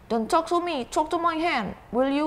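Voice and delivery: high-pitched pretend huff; high, lightly acted anger; mock angry. Shown as a demonstration. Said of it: "saking marahnya seperti itu".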